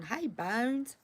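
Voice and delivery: ordinary talk, nothing marked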